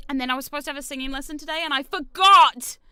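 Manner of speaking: loud frustrated outburst; exasperated exclaim. Real, not acted. Streamer confessing she forgot, loud exasperation. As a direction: blurt it out, annoyed at herself.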